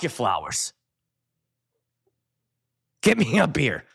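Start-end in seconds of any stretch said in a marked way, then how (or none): none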